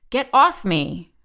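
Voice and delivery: loud, slow, restrained scream; screamed command. A narrator's reading. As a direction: force them away with a loud cry. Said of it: "she screamed".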